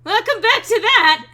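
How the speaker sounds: loud, high cheering welcome; excited shout. Real, not acted. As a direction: shout it out gleefully. Cheering a returning viewer.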